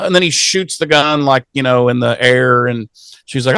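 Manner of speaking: ordinary delivery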